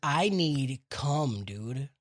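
mock old lady. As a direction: drawl it like a needy old woman. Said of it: "Literally says".

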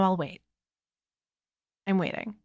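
softly teasing wait; quiet. Acted. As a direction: drop to a hush while waiting. Teasing pause, much quieter.

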